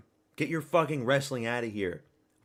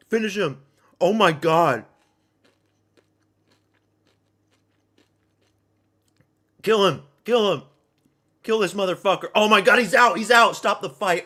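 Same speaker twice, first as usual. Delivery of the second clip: loud, high cheering for the finish; fight-night screaming; excited yelling; 9 semitones higher, 11 dB louder than usual. Real, not acted. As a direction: hype shouts at the screen.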